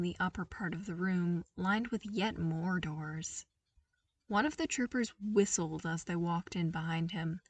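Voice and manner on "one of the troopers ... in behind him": usual speaking voice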